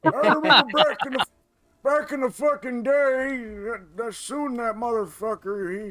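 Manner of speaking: put-on high, slow creaky old-timer voice; hammed-up old geezer; geriatric old man. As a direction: ramble like a cranky grandpa. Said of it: "we're all geriatric doing this".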